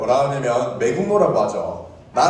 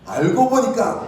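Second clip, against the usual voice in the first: higher, teasing reveal cue; suspenseful reveal; 5 semitones higher, 3 dB louder than usual. Shown as a demonstration. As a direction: lift it up to cue the audience. Repeats cue before the reveal.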